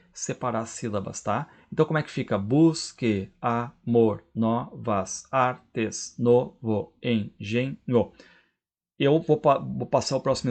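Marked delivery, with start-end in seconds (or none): 2.4-7.8 measured, separated syllables of a verse. Shown as a demonstration. Teacher showing students how to split a verse, syllable by syllable. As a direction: break each word into its syllables, pausing between them. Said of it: "separar as sílabas do verso".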